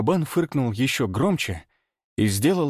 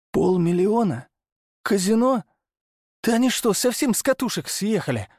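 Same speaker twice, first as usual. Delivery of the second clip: incredulous; 9 semitones higher than usual. A narrator's reading. Hunter appalled by clients' quarrel.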